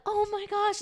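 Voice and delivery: very high, hammed-up gasp of alarm; mock parent panic. Parent faking panic to make the baby cry, hammed-up alarm. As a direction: squeal it in fake horror. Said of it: "but they're like".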